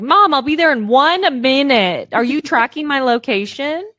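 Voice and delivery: loud, high pretend call to mom; fake phone call. Faking a call to mom when followed, loud pretend chat. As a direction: call out so a stranger overhears. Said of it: "I pretend I'm on the phone".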